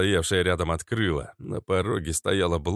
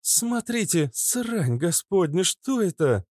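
higher, slower, shocked woman; startled woman; 11 semitones higher, slower than usual. A narrator's reading. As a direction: stare at it in shock. Natasha startled.

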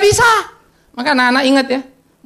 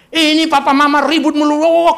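put-on higher, louder teen grumble; complaining teen; 8 semitones higher, 7 dB louder than usual. Teen complaining about quarrelling parents, loud whine. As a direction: gripe about noisy parents.

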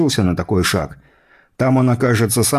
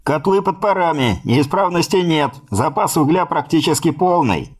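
higher report through a speaking tube; 6 semitones higher than usual. A narrator's reading. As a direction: sound distant, down a voice pipe. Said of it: "в одну из переговорных труб".

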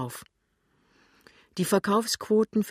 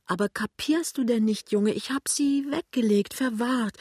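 high-pitched, urgent explaining; exasperated pleading; 7 semitones higher than usual. A narrator's reading. Wife explains to husband she put money away, exasperated pleading. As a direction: plead with him to understand at last.

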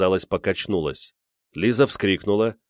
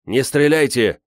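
high, loud urgent plea; sudden shout; 6 semitones higher, 5 dB louder than usual. A narrator's reading. Зазуля stops the man with the pistol, shouting. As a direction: burst out, raised and urgent. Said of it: "вдруг закричал Зазуля".

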